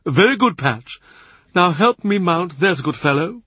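high, pleased voice of a grand churchman; amused old master humouring his fool; amused Cardinal. A narrator's reading. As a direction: lift the pitch, genial and amused. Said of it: "The Cardinal laughs".

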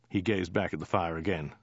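plain reading voice

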